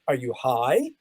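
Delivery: flatly incredulous at the director; incredulous retort. Retold or imitated.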